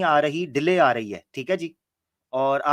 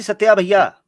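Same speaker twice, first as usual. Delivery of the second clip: calling out; 4 semitones higher, 8 dB louder, slower than usual. Real, not acted. Calling a silent guest.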